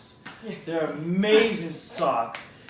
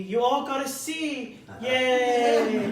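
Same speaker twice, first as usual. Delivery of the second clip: mock cheer; 6 semitones higher, much slower than usual. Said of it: "and she's like".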